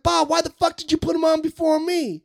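very high, outraged complaint; mock yelling. Emcee berating Bob over the running order, mock yelling. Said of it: "He was just yelling at Bob".

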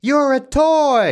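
high, loud, very slow "You're a toy"; hammed-up dramatic toy line; dramatic movie line. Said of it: "That's a line from the film".

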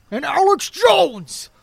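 loud, slow, drawn-out ranting impression; Alex Jones. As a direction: bellow it like a ranting radio host. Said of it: "you do a pretty solid Alex Jones".

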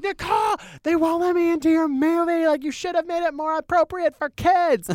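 put-on very high-pitched childish complaint; pestering fan kid. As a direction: reenact a kid harassing a star from the sidelines. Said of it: "harassing her".

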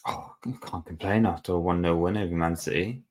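ordinary talk

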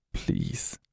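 hoarse, breathless whisper; croaked whisper. A narrator's reading. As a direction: barely voice the word. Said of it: "I croaked out".

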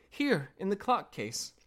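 put-on high-pitched little kid; little voice crying out. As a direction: pipe up in a small, high voice. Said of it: "a little voice cried".

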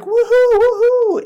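very high, loud whooping cheer; the optimistic kid jumping around, cheering wildly; excited whoops. Retold or imitated. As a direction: whoop like a kid who can't contain his joy. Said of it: "getting so excited".

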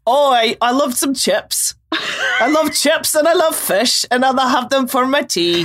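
muddled Scottish-or-New-Zealand accent attempt; dubious regional accent. Shown as a demonstration. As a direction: put on an uncertain Scottish-sounding accent, tickled. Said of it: "It's either Scottish or New Zealand".

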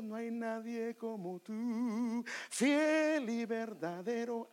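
slow fervent worship proclamation; proclamation demo. Shown as a demonstration. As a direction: lift your voice in fervent praise. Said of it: "entra la parte de quién es Él".

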